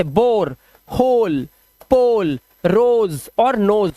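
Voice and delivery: "बोर, होल, पोल" with a long, round o; pronunciation demo. Shown as a demonstration. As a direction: stretch the ओ in "रोज" and "नोज". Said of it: "ओ का उच्चारण ओ ही होता है".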